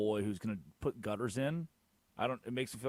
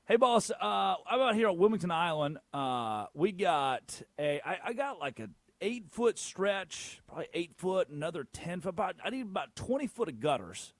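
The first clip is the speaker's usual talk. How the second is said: higher-pitched folksy Southern customer; Southern accent, pitched up to chat up a contractor; southern code switch; 7 semitones higher than usual. Acted. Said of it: "a southern code switch when I call".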